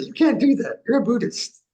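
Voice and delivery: imitating a prim, indignant reproach; mock scolding. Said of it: "They kind of say".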